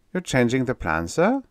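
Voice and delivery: sharply rising surprised question. Acted. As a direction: ask in disbelief. Aide surprised at general.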